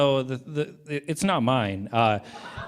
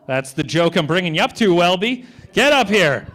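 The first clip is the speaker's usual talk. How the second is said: loud call; 5 semitones higher, 9 dB louder than usual. Real, not acted. Introducing next comic Welby.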